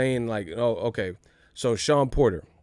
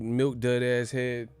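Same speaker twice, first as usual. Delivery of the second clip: normal talking voice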